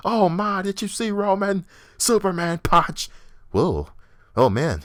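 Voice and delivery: high, loud, over-the-top commentary; mock an excitable ringside announcer calling moves; squealing play-by-play parody; hyped announcer. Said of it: "everybody can be out there giving some type of".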